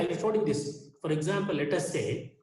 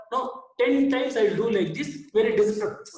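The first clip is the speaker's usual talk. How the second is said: louder, higher, acted-out frustration; mock exasperation; 5 semitones higher, 5 dB louder than usual. Speaker acts out forcing a cap on, mock exasperated. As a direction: play stubborn, exasperated insistence with raised volume.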